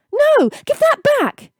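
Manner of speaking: put-on high, loud boyish protest; boy protesting as his case is grabbed. A narrator's reading. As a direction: cry out, give it back. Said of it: "he protested".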